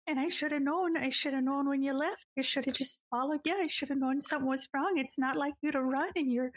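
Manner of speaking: high, tearful granny voice, sobbing it out; a grandma crying her heart out while hugging; bawling granny. Acted. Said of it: "Granny Tia is just, like, bawling on you".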